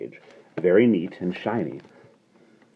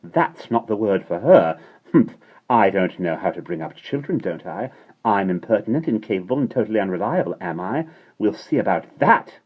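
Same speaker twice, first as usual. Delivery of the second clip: indignant fury; 4 semitones higher, 4 dB louder than usual. A narrator's reading. Mary Poppins furious at Miss Andrew.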